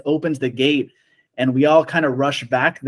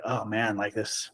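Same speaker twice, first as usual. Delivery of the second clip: low, much softer sinking aside; retold dismay; 5 semitones lower, 11 dB quieter than usual. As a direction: voice drops as if reliving the bad news. Retold sinking reaction to bad news.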